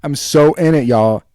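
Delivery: loud, high and thrilled; excited. Real, not acted. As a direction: shout it out proudly. Excited self-promotion of podcast.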